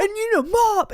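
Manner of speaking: high, loud mocking nag; grumpy partner impression. Mocking partner's morning orders, high and loud. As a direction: imitate him barking orders in a squawky high voice. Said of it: "he woke up in a shit mood".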